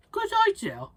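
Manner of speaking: very high loud squeal of approval; squeaky skeleton. Acted. Big skeleton agrees, in a high loud squeal. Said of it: "the big skeleton said".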